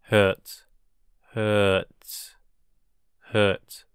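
ordinary talk, nothing marked

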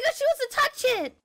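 high, loud, fast excited squeal. Real, not acted. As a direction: blurt it out in delighted shock. Excited reaction to touch request.

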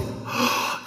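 soft gasp of awe; a short, hushed intake of breath; audience gasp. Retold or imitated. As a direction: gasp as if stunned by the screen. Audience's stunned reaction, acted out.

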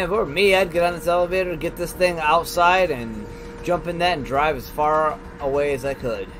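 ordinary talk

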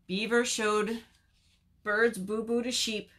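ordinary delivery

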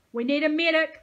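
loud, high call for help; shouted. A narrator's reading. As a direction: shout it over a crowd. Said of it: "someone shouted".

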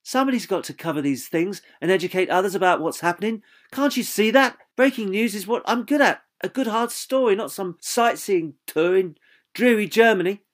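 firm tone. Acted. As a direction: insist on her calling. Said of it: "Jane replied with a firm tone".

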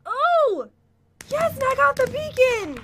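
high, delighted outburst; excited triumph. Real, not acted. Player wins the beacon, excited triumph.

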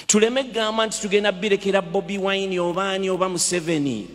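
usual speaking voice

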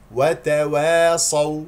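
"وتواصوا" slow and loud, thin wae before heavy ص; correct light و. Shown as a demonstration. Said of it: "اسمعني جيدا". (ترتيل "وتواصوا" بترقيق الواو)

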